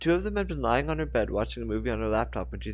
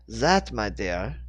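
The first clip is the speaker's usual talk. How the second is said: slow French; 3 dB louder, much slower than usual. A narrator's reading. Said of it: "Genevieve told her".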